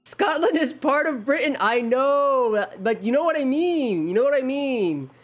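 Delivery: pleading, high-pitched "yes, I know"; exasperated concession. Real, not acted. Heading off nitpickers about his wording.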